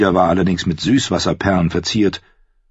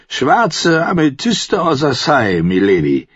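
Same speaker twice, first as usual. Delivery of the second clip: high, slow tipsy voice; drunken fool; 6 semitones higher, slower than usual. Acted. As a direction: drawl it out, pleased with himself. Drunk Dontos answers. (hoch, langsam und betrunken gezogen)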